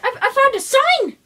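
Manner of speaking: very high, loud, stammering start; excited announcement. Pretend. Luigi telling Mario he found a sign, excited. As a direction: trip over the first word with excitement.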